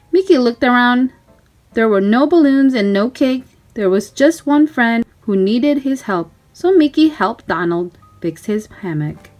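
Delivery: ordinary delivery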